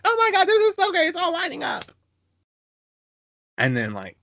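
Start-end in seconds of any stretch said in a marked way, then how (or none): 0.0-1.9 gushing squeal. Retold or imitated. Pastor's wife thrilled the match lines up: shrieking squeal.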